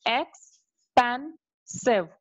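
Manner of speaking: Expensive drawn out slowly, ex-pen-sive; syllable by syllable. Shown as a demonstration. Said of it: "So three syllables are there".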